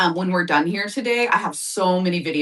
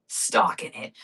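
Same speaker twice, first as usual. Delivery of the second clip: a hushed, playful creepy echo; mock spooky whisper; 4 dB quieter, slower than usual. Telling viewers about cats stalking the doves, mock spooky whisper. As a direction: breathe it out as a mock menacing whisper.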